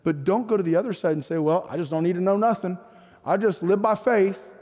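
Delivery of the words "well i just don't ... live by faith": mock folksy Southern accent. Pastor mimicking anti-study believers, Southern accent. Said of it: "I did that in a Southern accent".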